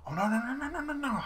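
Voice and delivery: high rising wail of no; panicked rising cries of no. Real, not acted. His shot goes wrong in game.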